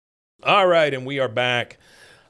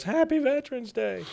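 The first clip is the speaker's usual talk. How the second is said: much higher, sing-song put-on woman's voice; cheery Peggy voice; 12 semitones higher than usual. Peggy greeting a disgusted parade crowd, high and cheery. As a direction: chirp it brightly to the crowd.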